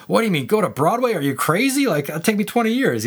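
high, laughing mock disbelief; incredulous retort. Imagined retort to a New York offer, high mock disbelief. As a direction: scoff at the idea as if it's absurd. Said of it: "I'd be like".

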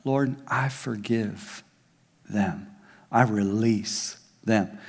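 soft, very slow prayer of release; quiet sincere forgiving prayer; heartfelt prayer. Pretend. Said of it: "When you say from your heart".

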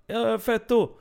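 high-pitched mock yell; imiterat skrik. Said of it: "så skriker han". (härmat, ljust rop)

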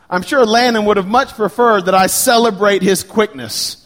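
ordinary talk, nothing marked

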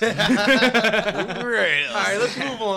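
high, loud laughing voice. Real, not acted. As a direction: laugh the words out slowly. Laughing echo of the joke.

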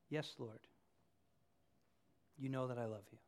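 barely above a murmur, humbled; humble Peter. Retold or imitated. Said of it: "listen carefully to how Peter responds".

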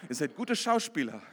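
ordinary delivery

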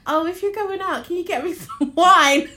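high, loud teasing quote breaking into laughter; loud mimicking plea for wine; mock text read-out. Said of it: "I had a text from her earlier that said".

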